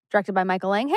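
ordinary talk, nothing marked

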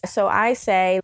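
ordinary talk, nothing marked

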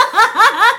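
bright high laugh; high burst of laughter. Real, not acted. Laughing at her own joke.

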